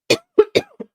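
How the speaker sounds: sudden high, loud cough; choked-up cough. Real, not acted. Streamer moved by a viewer's success, choked-up cough. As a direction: cough as the throat catches. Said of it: "I'm getting choked up about it".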